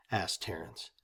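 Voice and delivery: usual speaking voice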